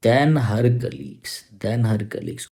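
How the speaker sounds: slow, deliberate phrase; teacher stressing the comparison; slow emphasis. Shown as a demonstration. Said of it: "अब ऐसे समझ जाओगे आप".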